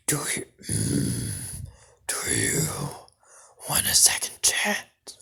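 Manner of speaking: throaty whispered demon attempt; strained, unsteady devil whisper; devilish voice. A narrator's reading. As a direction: try out an evil whispering devil. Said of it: "get the voice right".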